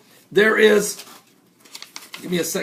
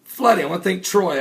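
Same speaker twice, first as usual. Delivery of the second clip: normal talking voice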